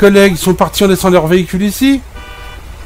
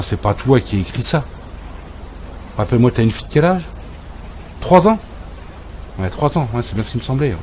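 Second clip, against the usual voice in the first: ordinary talk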